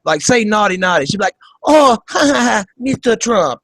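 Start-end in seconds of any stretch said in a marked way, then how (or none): 1.6-3.6 high mock giggle, Eastern European accent; giggly coquette imitation with a Slavic accent; Melania Trump. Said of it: "That's how she talks".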